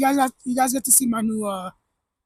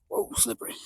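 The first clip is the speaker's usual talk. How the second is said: whispering; 5 semitones higher, 6 dB quieter than usual. Real, not acted. Slipping on an icy path.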